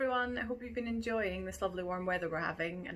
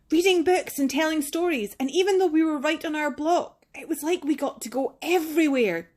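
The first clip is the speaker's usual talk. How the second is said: higher and louder, growing confidence; stronger voice; 9 semitones higher, 14 dB louder than usual. Acted. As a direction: speak up bravely before the class. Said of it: "your voice stronger than it was".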